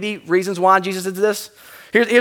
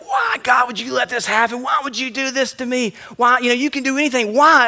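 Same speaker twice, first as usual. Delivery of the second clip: anguished cry to God; 4 semitones higher than usual. Retold or imitated. Grieving father crying out to God over his lost child. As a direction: hurl the question at God in grief. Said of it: "And I was like".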